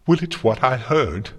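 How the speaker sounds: high, loud angry retort; bellowed. A narrator's reading. Twilight bellowing back at Celestia over what she heard, furious. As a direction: throw it back at full volume. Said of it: "Twilight bellowed".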